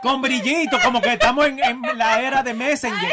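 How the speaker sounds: laughing while mocking the Tweety messages. Real, not acted. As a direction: talk through real laughter. (se ríe mientras se burla de los mensajes)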